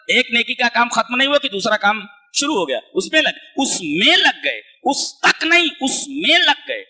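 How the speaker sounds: ordinary delivery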